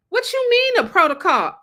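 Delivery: high, loud incredulous outburst; irritated. Retold or imitated. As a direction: fire it back, outraged at the idea. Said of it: "I was so irritated".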